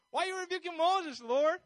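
high, loud questioning cry; incredulous protest. Acted. Asking the Lord why He rebukes Moses, incredulous protest. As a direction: protest upward in mock disbelief.

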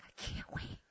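whispered, voiceless desperation; hushed mock agony; strained plea. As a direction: squeeze it out very softly. Strained plea, stomach hurting.

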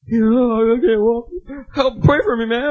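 put-on slow, high, wailing drunk; helpless drunk. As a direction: plead like a drunk friend, drawn-out and loud. Plays the drunk vomiting friend.

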